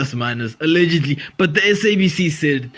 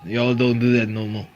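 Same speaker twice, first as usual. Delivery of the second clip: ordinary delivery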